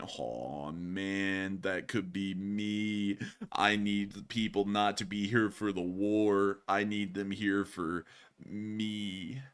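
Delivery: very low, slow, sleepy stoner drawl. Retold or imitated. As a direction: drag every word out, totally baked. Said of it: "that stoner voice you like to do".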